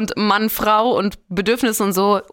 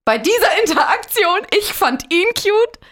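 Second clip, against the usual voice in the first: excited; 9 semitones higher than usual. Real, not acted. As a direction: stretch it out, high and giddy. Protests she liked him.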